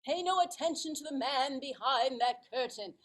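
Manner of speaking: a hint of a blustering showman; Wizard of Oz. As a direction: proclaim it to cover being exposed. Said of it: "and you hear this voice that says".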